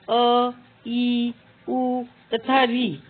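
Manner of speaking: slow, deliberate listing of the short vowels अ, ई, उ, री; धीमे-धीमे ह्रस्व स्वर गिनाना; slow vowel demo. Shown as a demonstration. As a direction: spell out each short vowel for students. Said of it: "कम समय लगता है".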